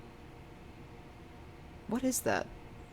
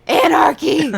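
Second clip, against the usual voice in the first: high, loud yell; shouted battle cry; 11 semitones higher, 13 dB louder, slower than usual. Pretend. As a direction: scream it like a rebel. Rejecting the demure trend.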